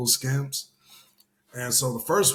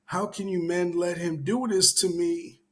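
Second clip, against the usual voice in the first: crying plea; 6 semitones higher than usual. Retold or imitated. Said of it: "screaming out to all the people coming out of the club".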